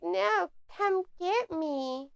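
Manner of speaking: slow, high, soft mock taunt; mock taunting wrestler. Mocking Julia Hart's slow, high taunt. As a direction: drawl out a silly dare. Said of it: "she's like".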